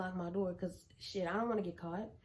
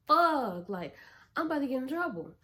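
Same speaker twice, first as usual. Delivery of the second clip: loud, higher panic; panicked curse; 4 semitones higher, 6 dB louder than usual. Retold or imitated. Caught as her brother opens the closet, loud panic. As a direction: blurt it out in fright.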